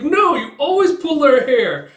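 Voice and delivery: high, loud, drawn-out complaint; indignant protest. Retold or imitated. Child protesting a haircut.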